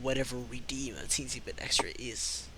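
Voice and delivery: low, soft sly innkeeper; sly lowdown innkeeper; shady innkeeper. Acted.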